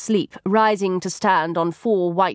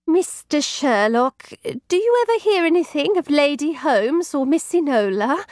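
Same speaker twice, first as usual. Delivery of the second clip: timid housekeeper; 8 semitones higher than usual. A narrator's reading. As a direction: ask it shyly in a higher voice. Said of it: "asks timidly".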